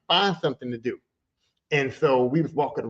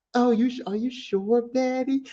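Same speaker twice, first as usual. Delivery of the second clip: mock stripper voice; 6 semitones higher than usual. Stripper voiced in retold story.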